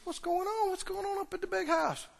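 high, soft puzzled question. Acted. Older son asking a servant about the big house, puzzled. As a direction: ask with hushed, high-pitched puzzlement.

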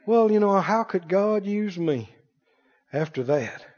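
doubtful self-pity. Retold or imitated. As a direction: sink into quiet self-doubt. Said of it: "They believe".

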